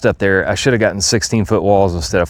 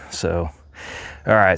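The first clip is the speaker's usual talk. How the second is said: ordinary delivery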